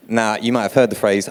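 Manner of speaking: ordinary talk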